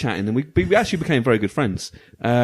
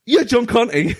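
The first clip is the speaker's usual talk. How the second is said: excited recognition; 10 semitones higher, slower than usual. Retold or imitated. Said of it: "I was like".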